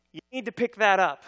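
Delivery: loud, controlled stern demand from dad; firm warning, holding rage back; restrained anger. Retold or imitated. Angry dad holding himself back.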